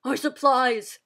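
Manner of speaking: father crying out in dismay; loud cry. Acted. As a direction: cry out at the loss. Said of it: "Father cried".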